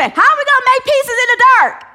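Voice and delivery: high, loud, frantic question; panicking crowd. Retold or imitated. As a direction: ask like someone lost in the dark. Panicking people in the dark.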